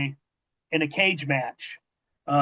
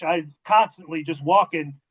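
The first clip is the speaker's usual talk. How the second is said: normal talking voice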